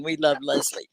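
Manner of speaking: ordinary talk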